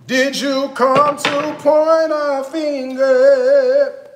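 mock church sermon drawn out slow; mock preacher. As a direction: preach it at him like a gospel pastor, then sing the end. Mock preacher sermon, sung ending.

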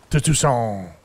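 gruff monster-hunter voice; Witcher voice. Pretend. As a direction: toast it like a grizzled witcher. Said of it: "So I can do my Witcher voice".

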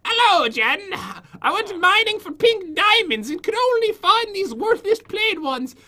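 high-pitched, hammed-up Irish voice; bad Irish accent. Villager sprite greeting Jen about mining, bad Irish accent. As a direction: put on a badly faked Irish lilt. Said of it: "Talk in an Irish accent, though".

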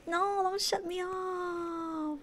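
high, slow pleading; whiny plea. Real, not acted. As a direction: drag it out pleading. Pleading with chat.

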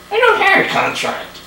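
high, whiny puppet voice; stubborn little dummy refusing; squeaky ventriloquist dummy. Acted. Dummy talks back.

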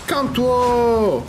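slow, stretched mocking calme-toi; drawn-out taunt. Real, not acted. Player slowly mocking an enraged enemy. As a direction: drag it out to mock him.

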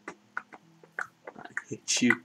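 slow drawn-out whisper. Acted. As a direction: breathe it out slowly. Repeating line in game.

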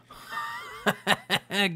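high-pitched laugh. Real, not acted. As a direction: laugh over the fight. Laughs during the fight.

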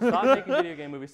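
loud, high, laughing command; mock shout. Real, not acted. Ranting at movie makers, loud laughing demand.

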